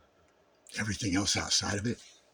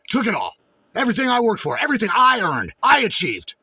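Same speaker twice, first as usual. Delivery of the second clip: mocking their accusations; 12 semitones higher, 7 dB louder than usual. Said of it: "they go".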